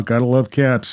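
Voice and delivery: ordinary delivery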